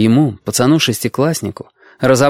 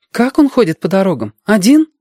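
put-on high-pitched girl's questions; amazed girl; 9 semitones higher, 3 dB louder than usual. A narrator's reading. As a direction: ask in wonder, lifting to a girl's high pitch. Girl amazed at lone traveller. (изумлённая девочка, высокий голос)

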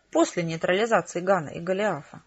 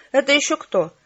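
curt, higher and louder challenge; sharp question; 6 semitones higher, 6 dB louder than usual. A narrator's reading. Gloomy agent challenging the new arrivals, sharply. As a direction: demand it sharply, pitched up. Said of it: "резко спросил высокий мрачный агент".

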